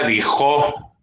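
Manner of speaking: "dijo" mispronounced with the stress on the final o; stress on last syllable. Shown as a demonstration. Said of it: "La palabra dijo no lleva acento". ("dijo" con acento en la última sílaba, di-JÓ)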